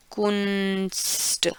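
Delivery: कुंस्ट drawn out slowly and softly, koonst; slow careful demo. Shown as a demonstration. Teacher slowly modelling German Kunst. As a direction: stretch कुंस्ट out quietly. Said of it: "कुंस्ट क्या होता है?".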